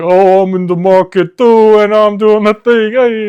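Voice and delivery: put-on excited worker bonding over accent; Jersey tough guy accent. Worker bragging to his buddy, loud hammy Jersey accent. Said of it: "it was just like".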